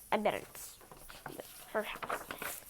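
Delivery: whispered secret. Acted. A reader whispering a guess to the listener. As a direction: let them in on it in a whisper.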